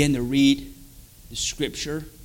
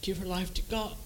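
soft urging; 2 semitones higher, 13 dB quieter than usual. Retold or imitated. Wife urging, retold.

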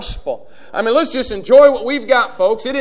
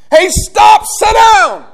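much higher, much louder shouted command; preacher's shout; 13 semitones higher, 12 dB louder, much slower than usual. Acted. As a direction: bark it at the whole room.

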